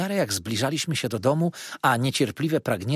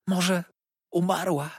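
ordinary delivery

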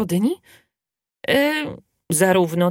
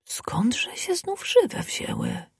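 whisper; 4 semitones higher, 7 dB quieter, much slower than usual. Acted. Fox whispering to himself.

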